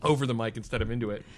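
far from mic. Real, not acted. Away from mic.

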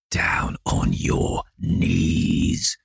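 very low, slow growl. A narrator's reading. James Vane to Dorian, a slow deep growl. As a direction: drag it out deep like a threat. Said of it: "growled the man".

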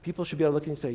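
ordinary talk

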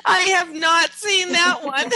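high, laughing through the words; real laughter breaking in. Real, not acted. Repeats it while laughing.

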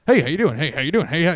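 imitating a fast, repetitive usher; rapid-fire greeter. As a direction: rattle off the greeting on a loop. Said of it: "he was talking really fast".

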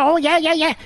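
high, eager mock yes-man; mock agreeing. Host imitating an eager politician, high mock yeses. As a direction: squeak out a spineless yeah.

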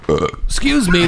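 normal talking voice

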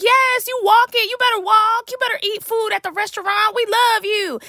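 high, gushing praise; gushing fan voice. Retold or imitated. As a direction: hype him up like a devoted fan. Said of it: "they'll just be like".

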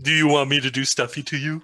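lightly voiced Stuffy character; a hint of Stuffy's voice. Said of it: "That's not a bad Stuffy".

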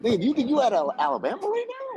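high-pitched incredulous comeback; mock-outraged question, squeaking up high; retold incredulity. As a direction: act out a disbelieving retort, voice climbing.

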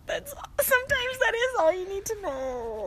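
high, crying wail, dragged out slowly; mock sobbing. Retelling a TV scene, sobbing high and drawn out. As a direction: blubber it through fake tears, high and slow. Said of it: "just sobbing, just sobbing".

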